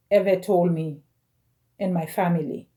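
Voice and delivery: ordinary talk, nothing marked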